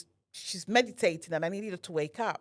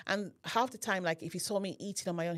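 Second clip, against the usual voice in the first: usual speaking voice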